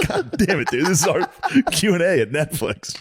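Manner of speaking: high-pitched comic exasperation; exasperated. Retold or imitated. To a panicking castmate at the Q and A, exasperated.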